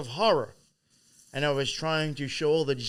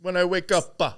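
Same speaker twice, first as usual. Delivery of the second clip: put-on Italian accent; 5 semitones higher, 7 dB louder than usual. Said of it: "has a heavy Italian accent".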